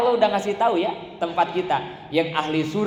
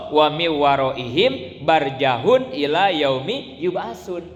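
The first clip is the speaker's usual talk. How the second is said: slow chanted Arabic verse; Quranic recitation; 4 dB louder, much slower than usual. Acted. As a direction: recite it slowly and solemnly.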